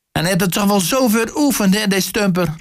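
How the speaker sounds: high-pitched protesting mother; mother's high cry of protest; calling out. A narrator's reading. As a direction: plead for your son in a high voice. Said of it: "riep de moeke".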